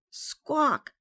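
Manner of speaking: loud, high parrot squawk. A narrator's reading. Parrot squawks at Matey, very loudly. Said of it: "very loudly".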